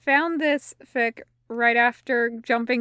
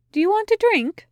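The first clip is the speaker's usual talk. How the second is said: bright invitation; 10 semitones higher, 3 dB louder, faster than usual. A narrator's reading. Angel invites wary demon for drink.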